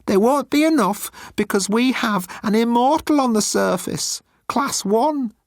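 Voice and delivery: high, timid alien voice; high-pitched nervous alien; Moan's voice. Acted. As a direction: play Moan small and pleading.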